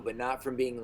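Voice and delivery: ordinary talk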